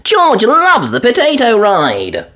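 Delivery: put-on high, piglet-girl voice; bright little girl gasp; young girl's delighted ooh; Peppa voice. A narrator's reading. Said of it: "explained Peppa".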